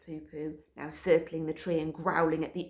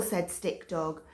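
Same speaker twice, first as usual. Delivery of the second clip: ordinary delivery, nothing marked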